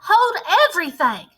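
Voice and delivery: high loud shriek. Acted. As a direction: shriek to halt the room. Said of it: "Her shrieking voice echoed".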